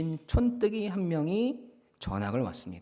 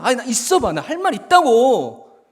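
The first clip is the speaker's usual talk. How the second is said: worked-up anger; 8 semitones higher, 12 dB louder than usual. Acted. Bully alone pretending he's being held back, angry bluster. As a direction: insist loudly that you have more to say. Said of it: "그렇게 더 화를 내는 거죠".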